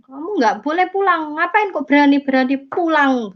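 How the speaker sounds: indignant scolding. Retold or imitated. Older sibling challenges the returning convert, indignant scolding. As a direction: imitate a relative forbidding a homecoming.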